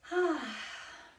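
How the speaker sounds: long, falling open sigh; settling sigh. Real, not acted. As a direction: let out a big settling breath. Settling in before the meditation.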